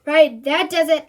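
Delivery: plain reading voice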